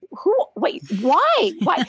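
high, baffled who and why; half-asleep at one in the morning, genuinely thrown; startled disbelief. Retold or imitated. Mother woken by her sons, confused and surprised. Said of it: "I'm like".

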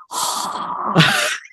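long exasperated sigh; big sigh. Real, not acted. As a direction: heave a deflated sigh. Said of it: "You know what sound I'm gonna make?".

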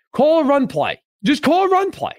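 high-pitched, pleading insistence; exasperated demand. Real, not acted. Fan rejecting excuses about play calls, exasperated demand. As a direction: plead with the coach, pitch leaping up.

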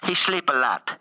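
put-on Italian accent as the Pope; thick Italian-English accent, playing the Pope; comic Italian accent as the Pope.

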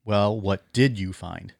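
slow quote with DID leaned on; Well, what DID you find?; stress on DID. Shown as a demonstration. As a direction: punch the word did, drawing the question out. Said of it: "with an emphasis on the word did".

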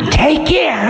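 high, sharp stern warning. Acted. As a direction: snap it out as a threat. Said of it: "said Time sharply".

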